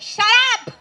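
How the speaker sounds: high, loud, exasperated outburst; mock angry shout. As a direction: imitate an angry man cutting everyone off. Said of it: "angry with us the whole time".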